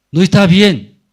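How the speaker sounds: high, loud objection; loud protest. Acted. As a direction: cry out that conscience objects. Acting out a leper's objection.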